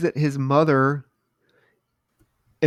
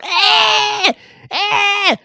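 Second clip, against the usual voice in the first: much higher, piercing "Eh!" cries; mock horrible scream; 4 dB louder than usual. Retelling the mother's scream, much higher shrieks. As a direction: imitate a horror-movie child scream. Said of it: "she starts screaming".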